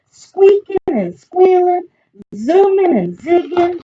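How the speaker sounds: high, rising sing-song list; excited storytelling. A narrator's reading. Reader describes the yard bursting into recess, excited.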